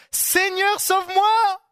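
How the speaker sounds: high, loud call for help; desperate cry. Retold or imitated. Sinking Peter crying to Jesus in panic. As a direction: cry out as you go under.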